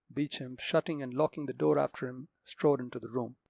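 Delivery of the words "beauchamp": ordinary delivery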